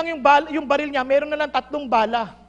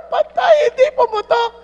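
high, loud mock wail of dismay; hammed-up dismayed hunter; 7 semitones higher, 5 dB louder than usual. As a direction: cry out in mock despair. Hunter dismayed gun fails.

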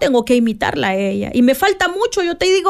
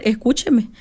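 normal talking voice

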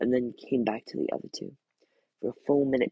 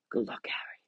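soft unvoiced murmur; whispered goodbye at the doorstep; 3 semitones lower, 7 dB quieter than usual. A narrator's reading. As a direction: murmur a farewell to the baby. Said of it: "he murmured".

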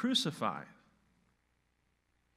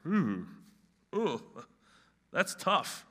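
acted wince at a hard prospect; daunted oohs, then an emphatic verdict; daunted Peter; 4 dB louder, much slower than usual. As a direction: play sizing up a tough road, with oohs. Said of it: "looked at the road ahead and said".

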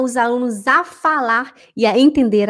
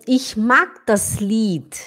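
slow example; much slower than usual. Shown as a demonstration. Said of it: "example read slowly".

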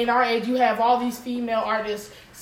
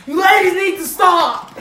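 barked drill sergeant order, higher and louder; military voice; 7 semitones higher, 8 dB louder than usual. Retold or imitated. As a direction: bark it like a strict drill instructor. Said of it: "speaks in the type of military voice".

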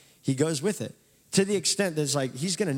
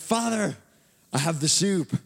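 high-pitched, eager call to father; hammed-up hopeful son; 4 semitones higher than usual. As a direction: announce it proudly as you walk in. Esau bursts in to his father.

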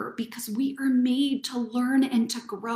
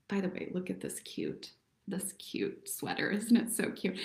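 much softer, half-whispered aside; hushed aside; 3 semitones lower, 12 dB quieter than usual. Real, not acted. Host pointing out her sweater to viewers, softly. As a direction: drop the voice for a quick aside.

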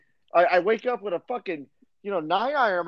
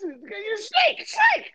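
rising mock shout; 14 semitones higher, much slower than usual. Real, not acted. Teasing a podcast guest as a snake, rising to a shout. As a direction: jeer at him like a hiss.

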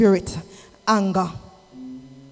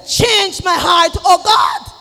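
shouted prayer; 6 semitones higher, 7 dB louder, slower than usual. Pretend. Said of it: "You better say".

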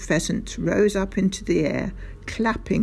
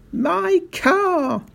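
very high, anguished shout; 11 semitones higher, 4 dB louder than usual. A narrator's reading. Mister Hazel frantic about his Rolls, shouting. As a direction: shout it in high-pitched outrage. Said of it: "shouted Mister Hazel".

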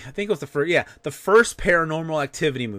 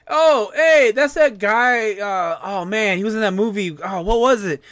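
mocking loud moviegoer; 6 semitones higher, 4 dB louder than usual. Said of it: "talking about the movie, you know, loudly".

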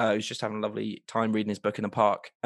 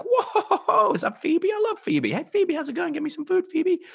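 much higher, excited put-on Joey; 3 dB louder than usual. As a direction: squeal with delight at seeing her. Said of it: "He's like".

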